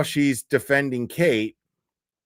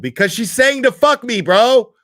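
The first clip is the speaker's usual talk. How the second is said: much higher and louder, a fed-up shout; exasperated yell; 9 semitones higher, 9 dB louder than usual. Real, not acted. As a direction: yell it out in disbelief at chat. Angry streamer answering chat.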